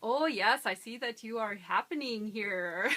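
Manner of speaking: comic German-accented English, overdone on purpose; a jokey stage German accent; slapstick German accent. Shown as a demonstration. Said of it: "doing a slapstick German accent's pretty easy".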